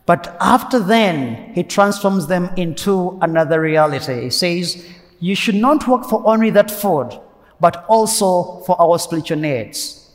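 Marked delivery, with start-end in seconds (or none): none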